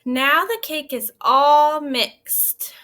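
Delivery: slow, loud read-aloud voice; storybook voice for children; story reading. Acted. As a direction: read it out slowly to the class. Teacher reads the story aloud.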